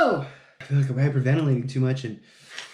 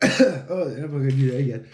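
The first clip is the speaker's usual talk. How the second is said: ordinary delivery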